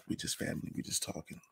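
whisper. Real, not acted. Whispered aside to viewers.

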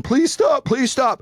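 high-pitched mock pleading; imitated crying plea. Father imitating the beaten kid begging, high mock-crying plea. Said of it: "the kid's crying".

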